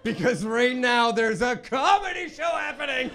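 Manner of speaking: high, loud laughing retort; mock heckler retort. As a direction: shout it back at a heckler, laughing. Answering imagined heckler.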